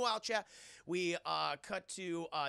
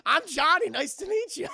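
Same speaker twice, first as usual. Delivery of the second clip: high, loud cheery intro; chirpy greeting; 9 semitones higher, 12 dB louder than usual. Retold or imitated. Johnny introducing himself to Scorpion, chirpy and loud. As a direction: introduce yourself all sunny. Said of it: "Johnny greets Scorpion".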